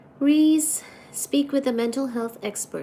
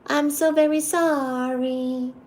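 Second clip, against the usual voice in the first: usual speaking voice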